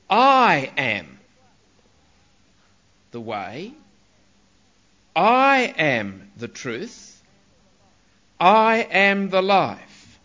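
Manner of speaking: very slow, "I" held and each phrase set apart; emphatic "I". Shown as a demonstration. Preacher answering those who say all religions are same, slow emphatic "I". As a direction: declare it slowly, leaning on "I". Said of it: "because of its emphasis".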